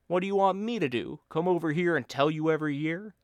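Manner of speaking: the friend hamming up a lecture; Bill's voice. Acted. Bill lecturing his friend on being an expatriate, mock rant.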